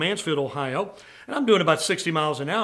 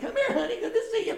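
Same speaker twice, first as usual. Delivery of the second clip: very high squeaky auntie voice; hammed-up cheek-squeezing aunt; gushing aunt; 4 dB quieter than usual. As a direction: coo at a child in falsetto. Aunt greets child, squeezing cheeks.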